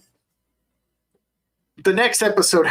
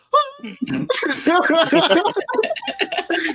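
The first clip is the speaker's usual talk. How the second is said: much higher, half-laughing, half-crying squeal; a giddy tearful laugh, pitched way up; 14 semitones higher than usual. Retold or imitated. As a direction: squeal like she's overjoyed and teary. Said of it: "And she's like".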